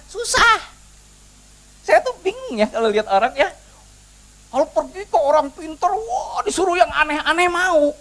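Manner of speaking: high-pitched, incredulous preacher's complaint; exasperated preaching. Real, not acted. Preacher chiding people who obey orang pinter, high and exasperated. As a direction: marvel at how contrary people are.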